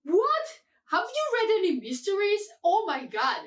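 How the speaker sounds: high, loud disbelief; scandalized, exasperated outburst; incredulous outrage. Real, not acted. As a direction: leap up in pitch, appalled. Outraged at the book's high rating.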